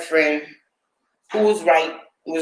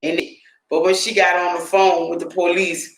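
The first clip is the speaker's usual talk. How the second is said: ordinary talk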